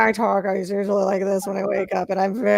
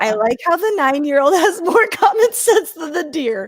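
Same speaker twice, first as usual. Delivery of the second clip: laughing; 5 semitones higher, 4 dB louder than usual. Real, not acted. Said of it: "laughing through comment".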